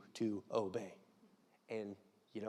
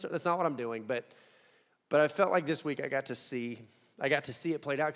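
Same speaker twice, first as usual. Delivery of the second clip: ordinary delivery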